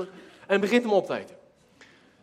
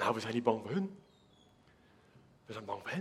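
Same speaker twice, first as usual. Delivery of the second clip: much lower and softer, a scared hush; frightened soldier; 9 semitones lower, 12 dB quieter than usual. Acted. Soldier confessing to the little man he fears the giant, hushed. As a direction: confess the fear under your breath.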